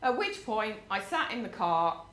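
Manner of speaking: usual speaking voice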